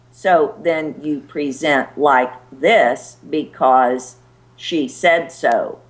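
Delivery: slow, over-careful plodding delivery; block speak. Shown as a demonstration. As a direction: present word by word, stiffly slow. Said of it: "that's block speak".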